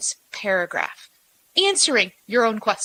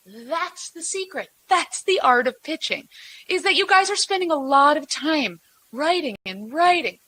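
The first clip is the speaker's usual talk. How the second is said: ordinary talk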